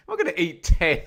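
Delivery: laughing protest. Real, not acted. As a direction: laugh it off while you protest. Laughing at a chat suggestion.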